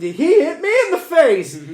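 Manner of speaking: high, loud acted-out protest; mock indignant yell. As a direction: shout it back in outrage. Acts out his indignant retort.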